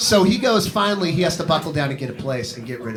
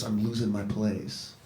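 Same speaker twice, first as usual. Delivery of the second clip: low, slow mock dejection; imitating a glum, upset friend; 7 semitones lower, 10 dB quieter, slower than usual. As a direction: sink the voice, soft and slow.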